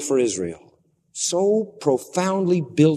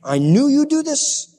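higher, exasperated "I knew you'd do this"; indignant quote; 12 semitones higher than usual. Acted. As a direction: repeat it with stress on "do". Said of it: "That's what he says".

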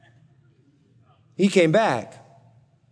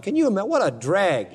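ordinary talk

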